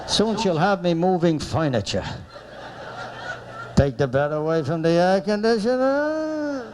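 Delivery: mock a long-suffering husband moaning alone; husband muttering to himself. Husband awaiting wife in hotel lobby, muttering to himself. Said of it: "He's talking to himself".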